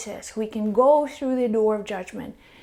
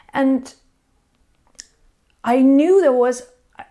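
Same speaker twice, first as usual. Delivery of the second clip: normal talking voice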